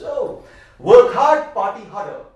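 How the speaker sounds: put-on loud, slow, proclaiming the old motto; mock motto. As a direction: belt it out like a party slogan. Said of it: "That was the motto of his life".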